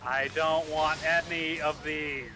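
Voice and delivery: slow, drawn-out complaint; stubborn refusal. Real, not acted. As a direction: drag the words out, refusing everything. Refusing the computer's setup prompts.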